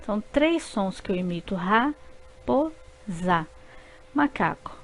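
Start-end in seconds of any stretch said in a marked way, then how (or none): 1.5-3.5 Ra-po-za drawn out slowly in three beats; slow syllables. Shown as a demonstration. Teacher repeating raposa syllable by syllable. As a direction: stretch Ra-po-za one syllable at a time. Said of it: "Eu abro a boca três vezes".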